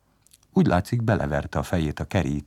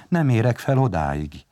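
mild higher-pitched whimper; 5 semitones higher, 4 dB louder than usual. A narrator's reading. Palkó cannot reach the dumplings, mildly whimpering. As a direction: plead with a touch of fear. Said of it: "szepegni is kezdett".

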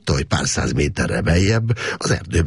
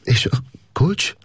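higher, slow, tight-throated question; choked voice; 11 semitones higher, slower than usual. A narrator's reading. A prisoner asks for the key in a choked voice. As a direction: ask with a choked, worried throat. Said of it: "elszorult hangon megszólalt".

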